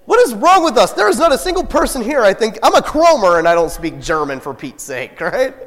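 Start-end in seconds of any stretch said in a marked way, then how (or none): 0.1-0.9 high, loud, bewildered outcry; a loud, high burst of confusion; baffled. Retold or imitated. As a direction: react to German on the screen, amazed. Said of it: "I'm like".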